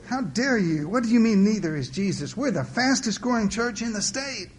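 high-pitched, affronted protest; indignant. A narrator's reading. Church wife defending her fast-growing church, indignant. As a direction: bristle in defense of your church.

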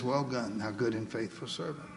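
lightly voiced God, low and soft; a low, hushed commendation from God; words of God. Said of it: "he says".

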